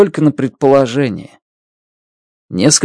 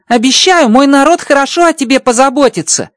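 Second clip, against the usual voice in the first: high, bright-pitched alien voice, amazed; a much higher voice for the alien friend; Rocky's alien voice; 14 semitones higher than usual. Acted. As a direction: play the little alien engineer, delighted. Rocky promises care.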